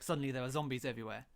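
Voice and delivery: ordinary talk, nothing marked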